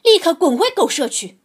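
fast, high furious scream. A narrator's reading. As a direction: shriek the order to get out. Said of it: "太太们尖叫着".

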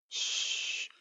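soft unvoiced shush. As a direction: hush him so grandma sleeps. Shushing to not wake bear. (轻声嘘)

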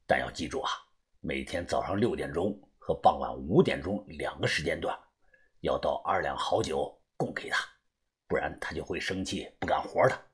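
very low, quiet secret reply; low-voiced go-between, keeping it discreet; middle-aged contact man. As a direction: answer the password in a deep hushed voice.

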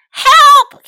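very high, loud cry for help; yelled for help. Acted. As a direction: scream for help as he slips. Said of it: "he yelled".

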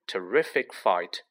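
plain reading voice

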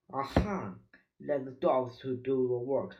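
usual speaking voice

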